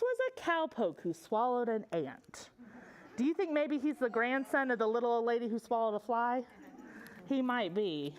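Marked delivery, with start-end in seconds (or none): none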